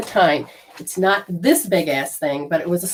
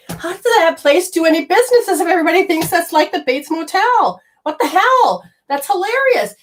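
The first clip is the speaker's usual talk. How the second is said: high-pitched, amused disbelief; incredulous outburst; 9 semitones higher, 3 dB louder than usual. Retold or imitated. Caller thinking about the creepy motel's business, incredulous. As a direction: pitch shoots up in mock astonishment.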